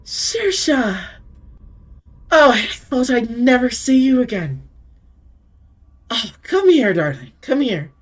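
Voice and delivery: high, loud, affectionate welcome; tender vampire lady. Acted. Deirdre greeting Saoirse with tender delight. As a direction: call her in like a long-lost darling. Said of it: "she smiles tenderly".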